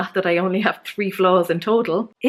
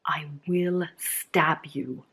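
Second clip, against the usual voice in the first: slow, much softer deadpan threat whisper; mock menacing whisper; 5 semitones lower, 8 dB quieter, slower than usual. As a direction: whisper a joke threat, slowly and darkly. Joking threat after annoying quote.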